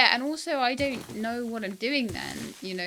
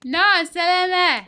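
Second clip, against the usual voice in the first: high, loud call from below; 8 semitones higher, 11 dB louder than usual. Real, not acted. Trapped player answers from the hole, calling out loudly. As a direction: shout it up in protest.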